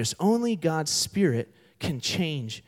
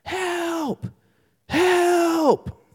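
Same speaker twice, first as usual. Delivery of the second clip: high, drawn-out cry for help; drowning man's yell. Acted. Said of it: "hears someone yelling out".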